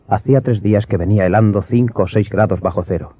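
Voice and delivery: ordinary talk, nothing marked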